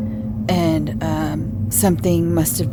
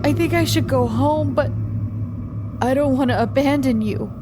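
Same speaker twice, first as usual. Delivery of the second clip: tearful, high and trembling; frightened young man close to tears; crying; 4 semitones higher, 5 dB quieter than usual. Acted. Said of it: "He was crying now".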